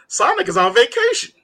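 high-pitched mock astonishment; amused disbelief. Retelling amused disbelief while reading.

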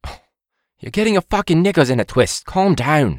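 high, mock British chef rant; a bad Gordon Ramsay impression; terrible Gordon Ramsay. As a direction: scold like a hammed-up TV chef. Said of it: "This is terrible Gordon Ramsay".

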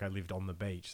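usual speaking voice

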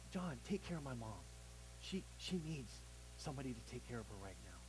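acted soft, low-key plea; hushed request. Jesus asking John to care for his mom, hushed.

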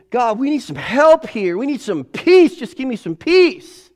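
high, loud pleading to God; desperate plea. Retold or imitated. Worn-out parent crying out to God. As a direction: cry out to heaven for help. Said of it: "desperate prayer".

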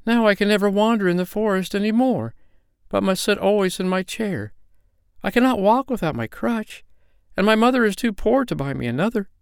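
put-on high, plaintive little girl; acted weeping voice, pitched well up; tearful lament. A narrator's reading. Girl mourns her lost crutch, tearful lament. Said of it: "wept little Nanny".

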